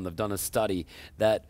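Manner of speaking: ordinary delivery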